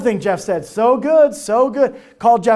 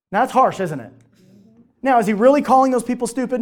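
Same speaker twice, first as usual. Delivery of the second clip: normal talking voice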